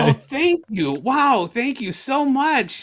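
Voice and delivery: delighted thanks. Retold or imitated. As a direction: gush with flattered surprise. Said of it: "somebody answers and goes".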